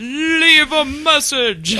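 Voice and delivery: announcer voice. Acted. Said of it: "You have reached".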